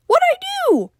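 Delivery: very high, squeaky little creature voice; squeak. A narrator's reading. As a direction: squeak it out defensively. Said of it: "he squeaked".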